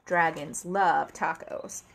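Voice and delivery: ordinary delivery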